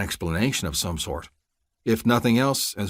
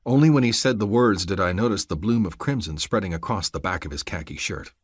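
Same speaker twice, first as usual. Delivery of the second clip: usual speaking voice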